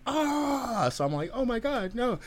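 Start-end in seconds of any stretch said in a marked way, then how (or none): 0.1-0.9 drawn-out mock wail of pain; retold scream. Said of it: "uh, screaming. He's like". 1.3-2.2 high, alarmed retold apology; hurried sorry-dude, pitched up; flustered apology. Retold panicked apology to injured man.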